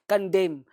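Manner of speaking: कंडेम closing on M; silent final N. Shown as a demonstration. Teacher models it after the warning, कंडेम without N. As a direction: end कंडेम at the M, two syllables. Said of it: "इसे नहीं पढ़ना है".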